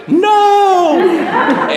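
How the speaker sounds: high, loud No! broken by laughter; a high, loud laughing yell of No!; laughing shout. Retold or imitated. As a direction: shout it again through a laugh. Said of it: "I had literally shouted".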